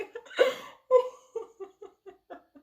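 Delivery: high laugh-sob; happy crying laughter; tearful laugh. Real, not acted. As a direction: laugh helplessly through tears. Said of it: "that I'm crying".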